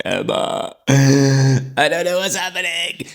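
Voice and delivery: high, slow, tearful plea to a friend; mock sobbing, pitched high; retold crying. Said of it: "crying my eyes out".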